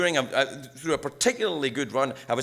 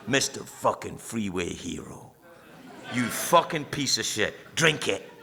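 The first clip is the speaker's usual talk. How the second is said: inner addict's taunt; 6 semitones lower than usual. Retold or imitated. Voicing his own addiction's taunt.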